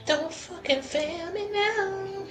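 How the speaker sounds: ordinary talk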